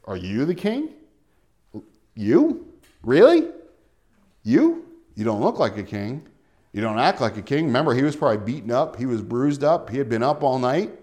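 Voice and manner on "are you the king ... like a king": incredulous questioning. Acted. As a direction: ask it in doubt, sizing up an unlikely king. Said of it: "there was an inflection in his tone".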